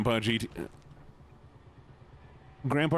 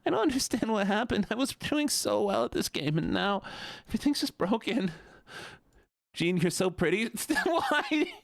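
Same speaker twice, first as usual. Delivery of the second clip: high whiny complaining; 7 semitones higher than usual. Pretend. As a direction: whine about the broken game. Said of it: "I'm doing the wrong voice".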